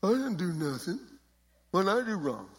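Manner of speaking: put-on snotty punk kid complaining. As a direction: play a defensive teen who did nothing. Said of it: "I need to get somebody with a real good, uh, punk voice".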